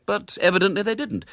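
plain reading voice

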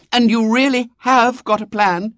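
put-on high eager girl; a poor girl hoping; little girl voice. A narrator's reading.

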